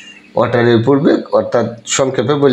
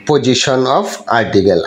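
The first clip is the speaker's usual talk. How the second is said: slow spelled-out heading; much slower than usual. Shown as a demonstration. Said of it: "লক্ষ্য করতে হবে যে".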